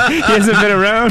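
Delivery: high laughing complaint. Real, not acted. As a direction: giggle through the words. Laughing complaint about Scott.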